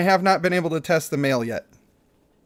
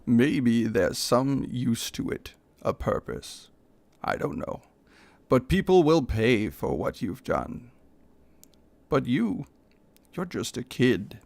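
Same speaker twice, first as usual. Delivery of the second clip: a lower, slow, far-off stranger's voice; low character voice; 4 semitones lower than usual. Acted. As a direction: speak low and slow to the dying orc. Story figure voiced low throughout.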